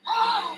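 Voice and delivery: sharp high gasp of shock. Real, not acted. Seeing the sealed jar lid fell off, sharp gasp. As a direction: suck in air, really startled.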